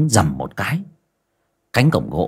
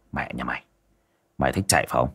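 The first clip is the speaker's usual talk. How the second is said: much softer, low seething threat; through gritted teeth; 8 semitones lower, 8 dB quieter, much faster than usual. Acted. Master seething at the runaway ghost, low gritted-teeth threat. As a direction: grind it out, barely above a breath. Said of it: "nghiến răng kèn kẹt".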